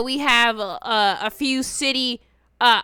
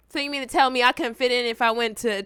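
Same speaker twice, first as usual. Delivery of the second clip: ordinary talk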